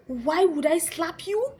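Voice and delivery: high, loud, drawn-out retort; mocking comeback. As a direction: imitate a lecturer throwing the question back. Lecturer throwing her question back.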